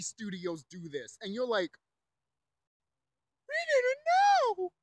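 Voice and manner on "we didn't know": very high, loud, drawn-out mocking voice; mock cluelessness. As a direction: imitate feigned innocence in an exaggerated high wail. Mocking studios claiming ignorance.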